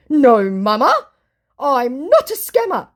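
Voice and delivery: hurt, protesting scammer; mock scammer voice. Scammer denying it to the vlogger, mock voice. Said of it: "he said".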